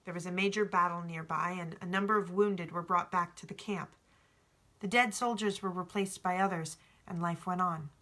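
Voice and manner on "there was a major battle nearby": plain reading voice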